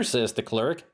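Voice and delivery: normal talking voice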